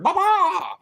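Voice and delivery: high squawking bird noise, mock; bird squawk imitation. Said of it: "mm, bird".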